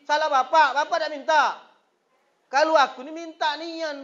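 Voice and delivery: preacher scolds his congregation for not asking, high and loud; full-force preaching. Acted. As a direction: rouse the congregation.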